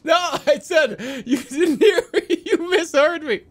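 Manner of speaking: real helpless laughter; laughing hard. Real, not acted. Streamer to chat, correcting them while laughing hard. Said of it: "Oh, I'm crying right now".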